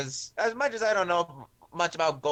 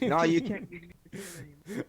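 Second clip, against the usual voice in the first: muffled, as if into a pillow; 4 semitones higher, faster than usual. Real, not acted. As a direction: talk with your mouth in a pillow. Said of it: "you're talking into a pillow".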